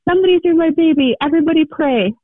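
urgent high-pitched re-enacted cry; retold yell. Mother recalling her plea for her son, retold yell. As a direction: call out as if appealing to a crowd, not full force. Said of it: "and I just yelled".

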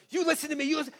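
high-pitched, tense scolding; about to lose it. Retold or imitated. Dad scolding teenage son in the parking lot, about to lose it. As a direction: imitate a parent on the verge of snapping. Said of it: "you're about to lose it".

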